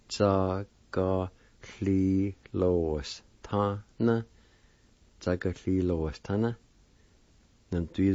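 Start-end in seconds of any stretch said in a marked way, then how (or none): 0.1-4.2 slow, evenly separated syllables. Shown as a demonstration. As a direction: draw each syllable out for the learner. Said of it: "it's pronounced like this".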